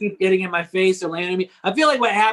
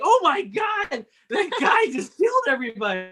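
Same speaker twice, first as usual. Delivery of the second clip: mock shock; 8 semitones higher than usual. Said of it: "they're like".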